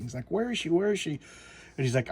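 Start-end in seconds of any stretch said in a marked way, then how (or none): 0.3-1.2 mock panic. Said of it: "he's kinda panicking".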